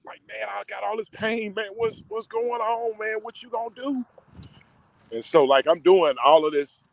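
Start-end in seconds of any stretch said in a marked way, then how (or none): 0.3-4.1 pleading cry to God. Retold or imitated. Crying out to God, retold.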